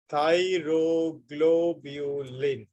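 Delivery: very slow, THYROGLOBULIN drawn out in separate syllables. Shown as a demonstration. Teacher repeats the new term to students, syllable by syllable. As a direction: break thyroglobulin into long, evenly spaced syllables.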